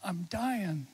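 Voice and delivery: soft, faint retelling of a dying man; very softly. Retold or imitated. Chaplain retelling a dying man's faint reply, very softly. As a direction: barely voice the words. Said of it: "very softly".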